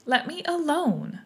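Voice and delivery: high, slow indignant plea. A narrator's reading. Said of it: "said Alice indignantly".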